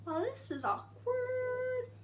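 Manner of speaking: high, slow, awkward aside; awkward deadpan. Retold or imitated. Walking in as everyone stares, slow awkward remark. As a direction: say it slowly, uncomfortably. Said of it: "And I'm just like".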